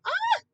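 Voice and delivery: sudden high yelp of strain; real pained yelp; sharp yelp. Real, not acted. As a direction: yelp as the body won't turn. Struggling to turn, yelps.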